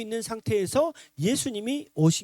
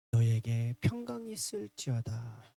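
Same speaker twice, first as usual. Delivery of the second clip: low, soft, slow blessing; gentle blessing; 9 semitones lower, 5 dB quieter, slower than usual. Retold or imitated. Jesus arriving among his disciples, gentle blessing. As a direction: bestow peace unhurriedly. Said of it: "예수님이 딱 오셔가지고".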